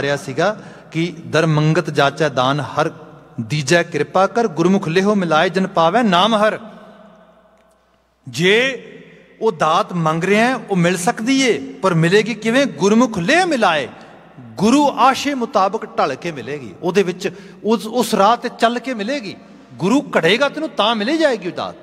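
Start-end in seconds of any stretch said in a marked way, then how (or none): 1.3-6.6 slow, raised scripture quoting; stately, emphatic verse recitation. Real, not acted. 8.3-21.7 high-pitched emphatic sermon delivery; impassioned exposition of scripture; fervent preaching. Real, not acted.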